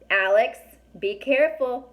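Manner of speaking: loud, sharp warning to his sister; warning call. A narrator's reading. Brother calling his sister back from the herd, loud warning. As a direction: call out to stop her in time. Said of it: "Connor said".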